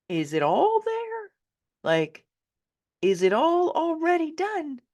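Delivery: high, slow disbelieving question; high incredulous wonder, drawn out; astonished. Retold or imitated. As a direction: ask it in wide-eyed amazement. Amazed questions about her chart.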